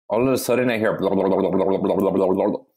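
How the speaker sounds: voiced gurgling noises from the belly; playful gurgle impression of a stomach. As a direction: mimic a bubbling stomach. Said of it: "from my stomach".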